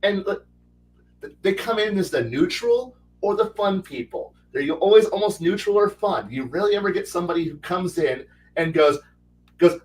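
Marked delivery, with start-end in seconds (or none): none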